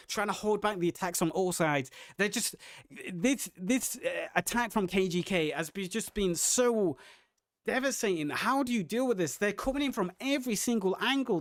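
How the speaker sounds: ordinary talk, nothing marked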